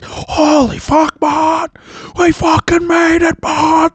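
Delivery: high, loud, shouted celebration; euphoric shout. Retold or imitated. Survivors shouting high and loud after surviving the crash. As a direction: yell it like you just cheated death.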